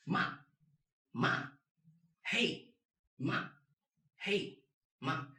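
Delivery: short "Ma" and "Hey", each falling sharply; fourth tone. Shown as a demonstration. Teacher matches Ma to the warning Hey, short sharp fall. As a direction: drop "Ma" sharply like an emergency "Hey". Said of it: "Exactly the same".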